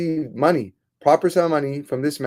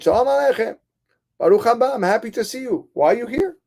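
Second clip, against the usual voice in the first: high, raised-voice welcome; hearty greeting; 10 semitones higher, 4 dB louder than usual. Acted. Old man welcomes the returning visitor, excited. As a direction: greet him with lively delight.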